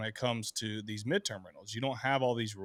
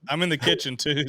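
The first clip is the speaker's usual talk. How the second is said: usual speaking voice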